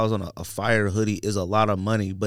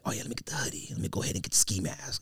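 softly mimicking someone bundling up, second line in a whisper; mock hushed muttering; 4 semitones lower, 11 dB quieter, faster than usual. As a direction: make fun of cold-weather overreaction in a hushed voice.

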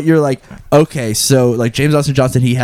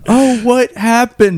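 high, slow fifties coma waking; hammy nineteen fifties film actor; stilted old movie melodrama; 11 semitones higher, slower than usual. Retold or imitated. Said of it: "nineteen fifties acting when someone wakes up from a coma".

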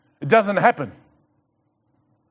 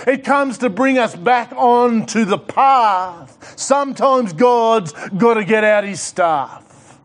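fiery preaching; 7 semitones higher than usual. Acted. Pastor urging the church to step out, in full preaching force. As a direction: build it up like a revival preacher.

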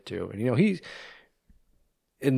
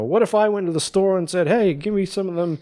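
loud, higher mock rant; retold furious rant; 8 semitones higher, 4 dB louder than usual. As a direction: rant like the unhinged nurse, half imitating.

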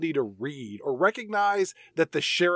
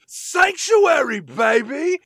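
slow, much higher and louder triumphant yell; screamed; 11 semitones higher, 8 dB louder, much slower than usual. Retold or imitated. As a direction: bellow it kicking the door in. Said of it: "he screams".

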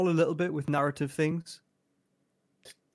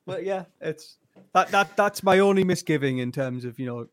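ordinary talk, nothing marked

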